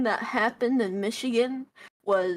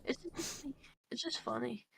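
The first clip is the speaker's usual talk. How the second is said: mumbling; 15 dB quieter than usual. Real, not acted. Mumbling after being teased.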